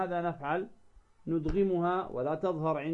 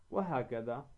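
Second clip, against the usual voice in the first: ordinary talk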